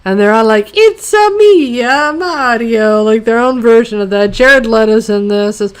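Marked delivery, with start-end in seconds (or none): 0.7-3.0 hammed-up, slow Italian accent; mock a terrible Italian accent, drawn out; cartoonish Italian accent starting high; bizarre Italian accent. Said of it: "the most bizarre Italian accents ever".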